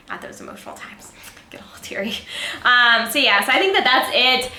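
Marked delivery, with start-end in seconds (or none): 0.1-2.1 hushed confiding whisper, much softer; whispered aside. Real, not acted. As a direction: drop to a secret whisper. Whispered aside about crying.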